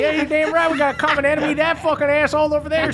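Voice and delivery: put-on high, fast old granny jeer; swearing granny. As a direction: spit it out like a grandma. GM plays granny NPC.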